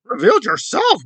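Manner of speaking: mocking her outrage over the rainbow; mock offended. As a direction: parody a dramatic accusation.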